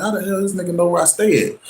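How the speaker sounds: high, incredulous mutter to himself; how does he know where I live?; baffled disbelief. Retold or imitated. Sizing up ex at door.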